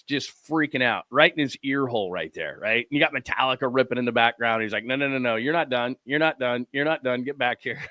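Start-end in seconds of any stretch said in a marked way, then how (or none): none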